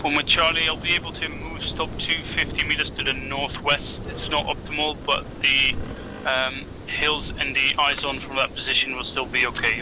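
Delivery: usual speaking voice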